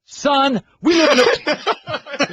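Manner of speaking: high, strained-for-drama movie speech; amateur attempt at a big courtroom monologue; slow, high, hammed-up delivery; Jack Nicholson lines. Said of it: "deliver the Jack Nicholson lines".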